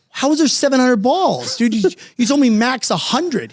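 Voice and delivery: can't believe what he's hearing; high disbelieving cry to a friend who miscalculated; shocked disbelief. Retold or imitated. Shocked at ball count.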